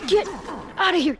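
ordinary delivery

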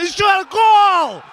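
slow drawn-out goal shout; excited hockey goal call. Real, not acted. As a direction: roar the goal out, stretched long. Hockey goal call by fan commentator.

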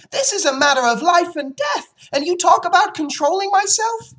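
put-on very high, loud child's tirade; outraged yelling. Fern rages at her father over life and death, yelling. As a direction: yell it at full pitch, appalled. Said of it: "yelled Fern".

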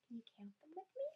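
quick hushed invitation to count; fast whisper. Shown as a demonstration. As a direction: lean in and whisper the question. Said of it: "teacher invites counting, whispered".

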